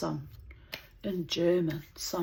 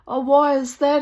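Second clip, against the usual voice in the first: mock challenge; 9 semitones higher, 13 dB louder than usual. Nazi man challenging her.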